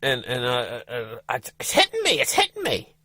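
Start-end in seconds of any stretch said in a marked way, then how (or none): 1.3-2.9 high, mildly panicked wife voice; a touch of rising panic; wife feeling the cramps hit, pitched high; panicking wife. Retold or imitated. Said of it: "starting to panic".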